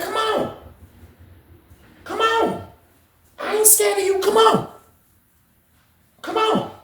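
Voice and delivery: very high, soft squeaky come on; squeaky taunt. Retold or imitated. BB taunting the dude to fight, very high squeaky voice. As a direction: tease him in a tiny high voice.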